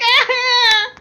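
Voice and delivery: high, loud fleeing scream, played for laughs; terrified scream. Valence screams as Kea chases him past. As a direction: yelp like someone running for his life.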